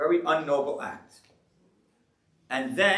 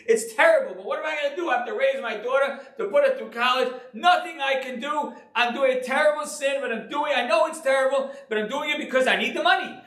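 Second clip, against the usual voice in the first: imitating the woman; 8 semitones higher, faster than usual. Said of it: "She agrees with you".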